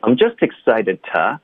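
slow, with "to" reduced to t; slow demo. Shown as a demonstration. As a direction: weaken "to" to a quick t. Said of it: "我们弱读成t".

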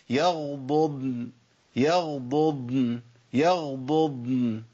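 يغضضن very slowly, both ض sounded apart; يغضضن: first ض voweled, second ض still; clear double dad. Shown as a demonstration. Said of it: "أقول إيه؟".

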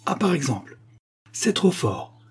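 exclamation d'une femme hors d'elle-même; beside herself. A narrator's reading. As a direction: explode with exasperation. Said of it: "s'écria Silence hors d'elle-même".

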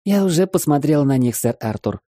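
put-on high, bright little girl chirp. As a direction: chirp it like a cheeky young girl. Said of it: "прощебетала девчушка".

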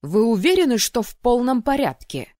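high, loud exasperated questioning; angry challenge. A narrator's reading. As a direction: demand an answer from an errant student. Roaring dean challenges the student.

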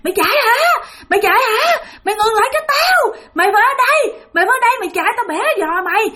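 very high, menacing shriek; screaming ghost woman. Retold or imitated. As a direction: scream threats at someone fleeing. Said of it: "tiếng bà này la làng ở phía sau lưng". (giọng bà ma la làng dọa nạt)

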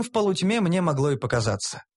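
ordinary delivery, nothing marked

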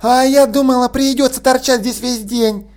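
impatient relative, lightly voiced; woman's voice. A narrator's reading. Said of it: "ответила женщина".

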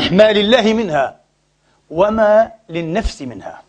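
very slow, measured phrasing; deliberate emphasis. Real, not acted. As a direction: draw out each half of the distinction. Deliberately spells out the key distinction. (تأنٍّ شديد في الإلقاء)

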